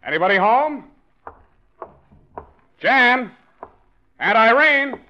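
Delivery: loud, high call through the house. Acted. George arriving home, calling for Jan and Aunt Irene, loud high calls. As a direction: call out to find who's home.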